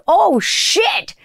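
high, delighted oh shit; thrilled to discover her favourite artist; excited surprise. Retold or imitated. Finding [name] on TikTok, a high delighted gasp.

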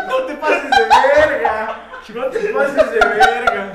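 slow, loud words through laughter; laughing so hard the words stretch; laughing disbelief. Real, not acted. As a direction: howl it out laughing. Reacting to gross joke.

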